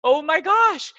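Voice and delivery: high hammed-up panic; panicked. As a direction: caught unprepared. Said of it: "I'm like".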